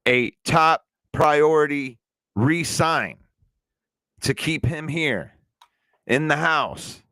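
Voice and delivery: slow, deliberate phrases with pauses between; measured, spaced-out delivery for someone not keeping up; nice and slow. Real, not acted. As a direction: spell it out patiently, chunk by chunk. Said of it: "say it nice and slow".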